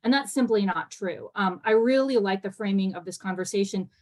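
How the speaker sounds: usual speaking voice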